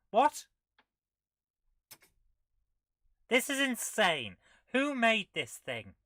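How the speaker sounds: loud amused incredulity; incredulous. Real, not acted. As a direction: exclaim at how absurd it is. Reacting to absurd game.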